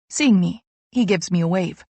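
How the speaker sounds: plain reading voice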